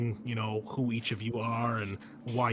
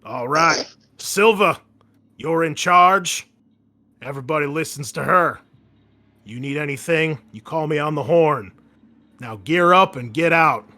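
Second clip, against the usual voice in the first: louder, clipped commander finishing a briefing; barking final orders to the squad; commanding orders; 3 semitones higher, 11 dB louder than usual. Acted. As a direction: send the squad out like a colonel.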